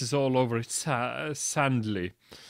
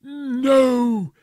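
much higher and louder anguished no; dramatic cry; 12 semitones higher, 10 dB louder than usual. Acted. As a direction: cry it out in despair.

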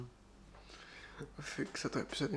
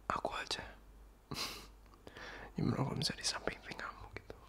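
teasing playful whisper; coaxing whisper, a little giggly; flirty whisper; 2 semitones lower, faster than usual. Acted. Flirty ASMR roleplay continues.

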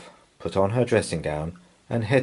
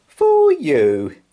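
beaming; 9 semitones higher, 8 dB louder than usual. A narrator's reading. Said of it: "he beamed".

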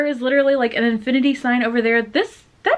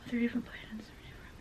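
murmured under the breath, nearly voiceless; whispered reading; 3 semitones lower, 20 dB quieter than usual. Real, not acted. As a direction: whisper it to yourself while reading. Reading text to self quietly.

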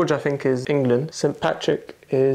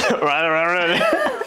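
laughing; much slower than usual. Real, not acted. Laughing at tongue twister.

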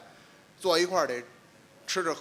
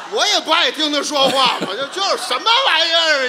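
loud, laughing protest; indignant shout; 10 semitones higher, 11 dB louder than usual. Acted. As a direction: shout it out in mock outrage. Indignant comic outburst.

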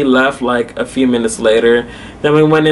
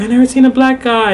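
high-pitched retold astonishment; surprised; 10 semitones higher, faster than usual. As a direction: imitate her amazement, pitched way up. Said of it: "the lady was just so surprised".

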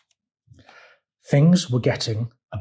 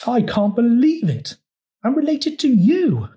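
high, louder gasping disbelief; gasped; 10 semitones higher, 3 dB louder than usual. A narrator's reading. Frog reacting to being related to Toad, high gasping disbelief. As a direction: blurt it out in amazement. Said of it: "gasped Frog".

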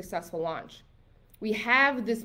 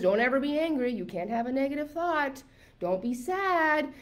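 mock rule-giving; 5 semitones higher than usual. Coach quotes law of attraction rules, mock admonishing. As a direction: parody a preachy list of don'ts.

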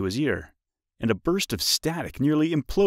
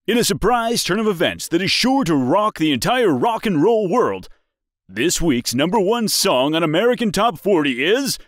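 excited radio DJ announcing; 4 semitones higher, 6 dB louder than usual. A narrator's reading. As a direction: build up the big reveal.

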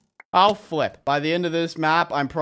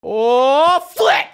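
loud, high cry climbing higher; frustrated yell; 10 semitones higher, 8 dB louder than usual. Real, not acted. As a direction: yell out at a sudden fail. Fails the harder level.

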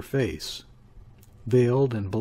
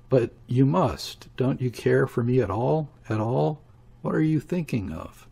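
ordinary delivery, nothing marked

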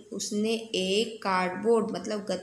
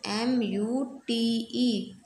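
slow spelling; much slower than usual. Shown as a demonstration. Teacher spelling mute for students, slow and clear. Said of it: "spells the word".

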